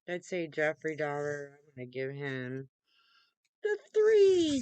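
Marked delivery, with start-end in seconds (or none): 3.6-4.6 emphatic. Real, not acted. Scoring Jeffrey Dahmer top mark.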